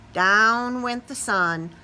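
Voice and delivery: usual speaking voice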